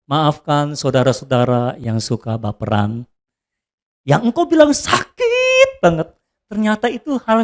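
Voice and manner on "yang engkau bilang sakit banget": mock crying. Mocking self-pity.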